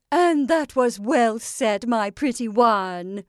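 slow, loud crowing praise; approving witch. Acted. Witch praises her pretty one, loud slow approval. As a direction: praise it loudly, drawing the words out.

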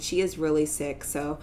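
ordinary talk

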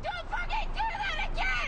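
high-pitched, furious outburst; angry yell. Acted. Acted angry outburst at the friend.

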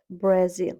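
Brazil as bra-ZIL; Brazil, end-stressed; stress on second syllable. Shown as a demonstration. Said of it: "Наголос падає на другий склад".

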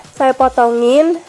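normal talking voice

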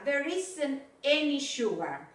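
There isn't any sugar, any stressed, said slowly. Shown as a demonstration. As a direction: lean on the word any. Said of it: "Ojo con la gramática".

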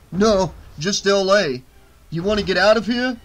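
rough and deadpan; gruff bouncer. Acted.

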